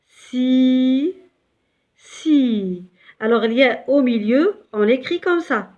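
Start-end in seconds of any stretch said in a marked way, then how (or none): 0.1-2.9 si stretched out; long si. Shown as a demonstration. Teacher showing the ya prolongation, long si. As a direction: hold the i of si.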